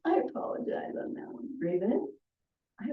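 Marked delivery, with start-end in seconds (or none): 0.1-1.6 whispered aside. Real, not acted. Aside apology mid-exercise.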